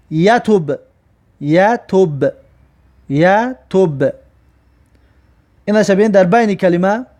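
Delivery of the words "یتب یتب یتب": یتب stretched slowly; slow recitation. Shown as a demonstration.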